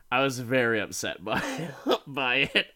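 normal talking voice

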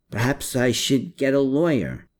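ordinary delivery

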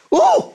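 high startled yelp; a shocked, squeaky cry; unmanly yell. Retold or imitated. Startled by a builder's yell, a high yelp. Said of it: "makes me yell. Not so manly".

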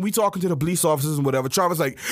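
ordinary talk, nothing marked

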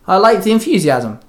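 usual speaking voice

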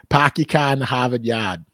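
put-on Boston accent, r's dropped to ah, pahk your cah. The host shows how a Boston local says it, Boston accent. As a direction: drop every r like a Bostonian. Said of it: "a person from Boston would be like".

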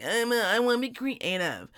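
whiny imitation of the spouse; lightly voiced self-pitying whine; mocking spouse voice. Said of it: "and you're like".

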